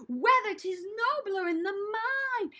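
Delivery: high, slow, swooping recitation; theatrical, drawn-out declaiming; moving while reciting. Shown as a demonstration. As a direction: stretch the line grandly as you move. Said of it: "So let's add movement".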